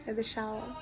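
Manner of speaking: usual speaking voice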